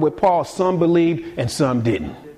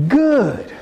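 sharp retort; 9 semitones higher than usual. Acted.

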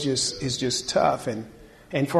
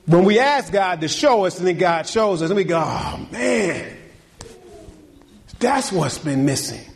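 rising preaching; 6 semitones higher than usual. Acted. Pastor urging fathers to cry out, building preaching. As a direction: push the sermon up to its peak. Preacher climbs again to climax.

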